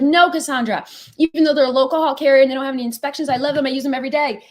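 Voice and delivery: fast, high, loud defence of a favourite; imitated protesting broker. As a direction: gush in protest as an imagined user. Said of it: "you're gonna be like".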